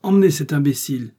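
forceful, annoyed dismissal; impatient order. A narrator's reading. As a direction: order him away, exasperated. Cardinal cuts off the pleading.

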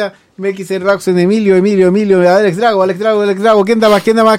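fast auction chant of names and bids; auctioneer. Pretend. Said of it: "de los locos que hacían remate".

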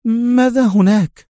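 ask, suddenly uneasy; mildly anxious question; worried. A narrator's reading. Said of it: "لتسأله في قلق".

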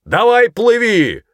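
high, loud, drawn-out call; commanding shout. A narrator's reading. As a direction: holler across the water. Sergeant shouts to boy again. (протяжный громкий окрик)

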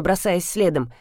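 plain reading voice

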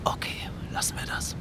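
whispered. Acted. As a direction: let it fade into a whisper. Moved, ending the topic.

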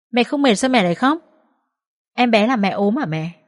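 a hint of a concerned small child; lightly childlike, puzzled concern; lightly voiced little boy. A narrator's reading. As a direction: question mother innocently, like a young son.

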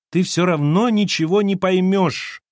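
high, loud desperate cry; shouting. A narrator's reading. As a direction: cry it out in despair. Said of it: "крикнула Лена". (отчаянный крик)

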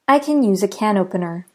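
first "can" weak like "Ken", "can opener" full; reduced can vs full can. Shown as a demonstration. Teacher showing two ways to say can. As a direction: contrast the two cans. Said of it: "the can after I sounds different".

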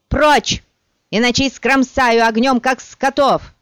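high furious yell; furious shout. Acted. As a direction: threaten them at full volume. Sorceress threatening the mob.

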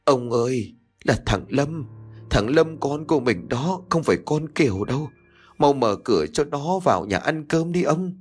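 wife begging her husband to open the door, halting; broken, halting voice. Acted. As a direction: gasp it out in bits to your husband. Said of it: "nói với một giọng điệu đứt quãng".

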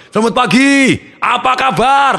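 high, loud showman greeting; booming high welcome to the crowd; seminar host's opening. Retold or imitated. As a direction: open the seminar like a big stage host. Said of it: "seperti saya kalau membuka acara".

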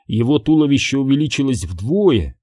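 plain reading voice

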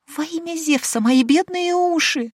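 very high, slow, pained wail; pained groan. Acted. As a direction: moan as if ears hurt. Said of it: "застонала Деспина".

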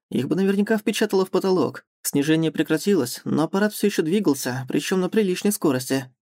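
usual speaking voice

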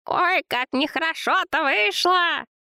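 very high, slow fussing lament; lamenting woman. A narrator's reading. Zina emerges from the tent, high lamenting fuss. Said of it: "Охая и причитая из палатки появилась Зина".

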